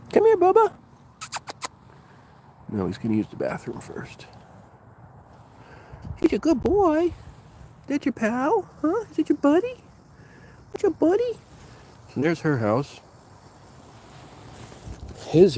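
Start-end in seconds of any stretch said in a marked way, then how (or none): 0.1-0.7 very high, loud calling to the dog. Real, not acted. 6.2-11.4 very high coo with rising questions. Real, not acted. Praising dog in pet voice.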